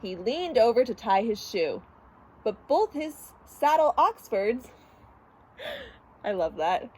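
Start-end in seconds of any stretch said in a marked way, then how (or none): none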